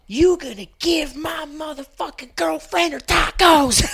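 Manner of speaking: shouted high furious demand; angry yell. Retold or imitated. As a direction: yell it at the window like a furious customer. Retold furious tacos demand.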